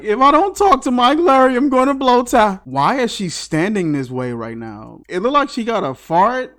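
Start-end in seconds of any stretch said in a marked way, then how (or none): 0.0-2.6 higher, louder strained plea; mock desperate Julie. Imitating Julie pleading for Mike, strained and louder. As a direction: squeeze it out like you're about to burst. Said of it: "She was like".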